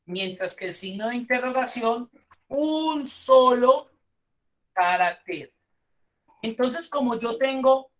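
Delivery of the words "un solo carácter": "un solo" stretched slow, high and loud; emphatic contrast. Shown as a demonstration. Teacher contrasting the question mark wildcard, drawn-out stress on "un solo". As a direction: hold "un solo" high, pause, then land "carácter".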